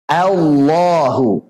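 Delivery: "Allahu" with a long, thin opening A; "Allahu", A stretched slow and thin; drawn-out hamzah. Shown as a demonstration. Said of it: "panjangkan dulu". (hamzah tipis dipanjangkan)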